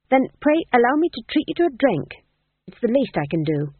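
nervously polite invitation, starting high. A narrator's reading. Fool offering his rescuers a drink, nervously polite. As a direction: offer it eagerly, a touch nervous. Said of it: "said the fool nervously".

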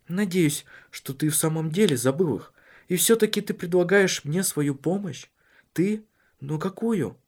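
a worried wife pressing her husband; Cecilia's voice. Acted.

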